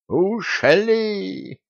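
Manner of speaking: high wailing cry. A narrator's reading. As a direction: howl it out in despair. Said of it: "взвыл Гордей".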